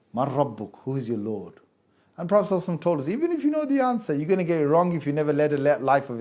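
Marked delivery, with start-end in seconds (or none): 0.1-1.5 low, soft interrogating angel; grave angel questioning. Retold or imitated. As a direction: question the dead from the dark, low and quiet.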